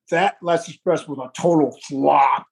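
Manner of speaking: low, slow Oklahoma twang; [name]'s Oklahoma twang. Retold or imitated. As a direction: drag the vowels out in an Oklahoma twang. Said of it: "with your Oklahoma twang".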